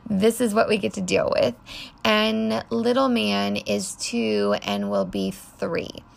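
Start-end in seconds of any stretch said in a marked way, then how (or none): none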